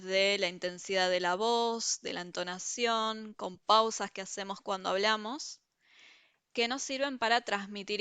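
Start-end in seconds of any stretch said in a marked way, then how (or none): none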